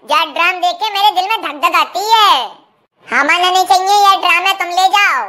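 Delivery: ordinary talk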